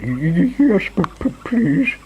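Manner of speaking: high, timid stutter; quivering stammer. A narrator's reading. Mister Jelly asks the tramp for the secret, quivering. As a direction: stutter it out in a frightened squeak. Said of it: "quivered and shook and said".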